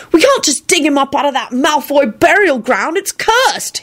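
high, alarmed protest; shuddering. A narrator's reading. Tim refusing to dig up Malfoy, shuddering. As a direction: protest high with dread of the curse. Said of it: "he shuddered".

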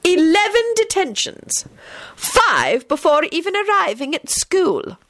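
high, slow, icy headmistress. A narrator's reading. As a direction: measure out each word in cold disapproval. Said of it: "announced in an icy tone".

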